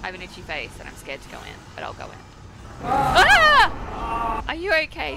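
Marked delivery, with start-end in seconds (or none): 2.8-4.4 startled yelp. Real, not acted. Startled in horror game.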